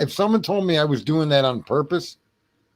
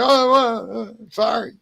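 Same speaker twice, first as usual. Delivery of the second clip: sheepish apology; 8 semitones higher, 4 dB louder than usual. Retold or imitated. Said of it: "I'd be like".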